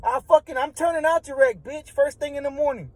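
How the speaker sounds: answering the threat, mock defiant. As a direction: toss it back, accepting the challenge. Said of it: "So I tell him".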